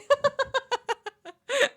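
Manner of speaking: squealing laugh up high; high burst of laughter. Real, not acted. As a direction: let a delighted laugh burst out. Amused by coworker story.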